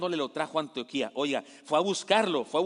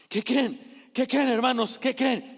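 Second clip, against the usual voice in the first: high, slow, bursting with news; breathless excited friend with big news; euphoric announcement; 8 semitones higher, 4 dB louder, slower than usual. Acted. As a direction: call out the good news, thrilled. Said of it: "Llega Bernabé emocionado".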